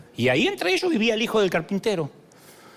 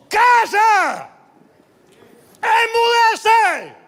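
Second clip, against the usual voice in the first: much higher, loud yelled rebuke; furious shout; 7 dB louder, much slower than usual. Acted. Jesus rebukes the storm, loud furious shout. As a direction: scold the storm at full voice. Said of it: "increpó y gritó".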